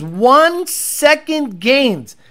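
slow, scornful repeat; mocking. Real, not acted. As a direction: drag it out in disbelief.

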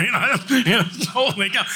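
high, laughing through the words; real laughter breaking into the telling. Real, not acted. Telling about the note to his father, laughing.

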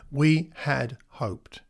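WE HAD HOPED very slowly, word by word; slow, weighty repeat. Retold or imitated. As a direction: let each word of "We had hoped" stand alone.

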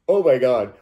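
loud gasp of alarm; frightened outcry. Retold or imitated. Retelling fear of the swinging chandelier, loud cry. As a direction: blurt it out in fright.

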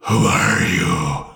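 slow, drawn-out monster voice; menacing werewolf. Acted. Wolf confronts the woman who punched it, menacing creature voice. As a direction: question it slowly as a wolf creature. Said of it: "one of them says".

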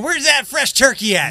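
high, oblivious mock demand; clueless latecomer. Latecomer arriving at dinner, high clueless mock demand. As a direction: play the late guest expecting turkey. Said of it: "you show up after work at eight thirty going".

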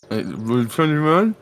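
slow, puzzled repeat with mouth full. Acted. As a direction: chew and echo the number back. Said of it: "Don't talk with your mouth full".

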